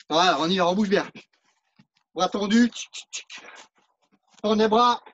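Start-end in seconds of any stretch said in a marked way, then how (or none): 2.7-3.4 bruitage chuchoté. Shown as a demonstration. Coach mimics punch sounds.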